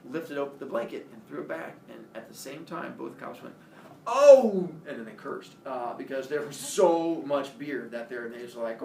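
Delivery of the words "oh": loud, high "oh!"; shocked cops' outcry. Retold or imitated. Two cops uncovering a pile of beer, shocked outcry.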